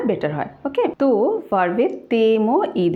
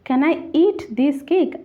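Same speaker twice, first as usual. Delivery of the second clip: very slow, higher, word-by-word read-out; slow dictation; 7 semitones higher, much slower than usual. Shown as a demonstration. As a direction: dictate each word slowly for the class. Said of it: "first exercise sentence".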